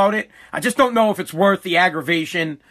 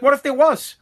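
higher-pitched imitated affronted father; retold protest, lifted in pitch; mock indignant; 6 semitones higher than usual. Son retelling father upset about receipt check, mock indignant. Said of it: "my dad was pissed".

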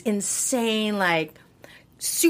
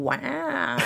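imitating the writer's wow; 7 semitones higher, 4 dB quieter than usual. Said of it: "she has a way of saying".

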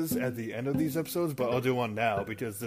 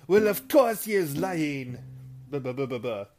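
sing-song mock; 3 semitones higher, 5 dB louder than usual. Mocking Madame Maxime's blather.

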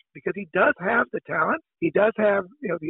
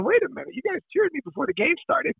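higher, put-out complaint; retold bewildered objection, pitched up; mock protest; 8 semitones higher than usual. Pitcher to fans who turned on him, mock indignant.

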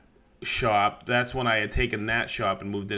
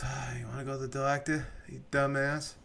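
a hint of a scornful wife, quick and soft; lightly voiced wife, fast and hushed; wife's scolding; 9 dB quieter than usual. Marianne scolding him at home, lightly voiced scorn. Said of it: "and she goes".

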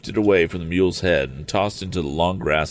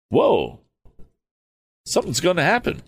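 alarmed cry; 12 semitones higher than usual. A narrator's reading. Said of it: "cried the man".

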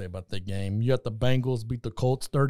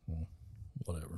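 low, very soft indifferent grumble; Belichick; 7 semitones lower, 19 dB quieter than usual. Acted. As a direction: act totally checked out. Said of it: "And Belichick's like".

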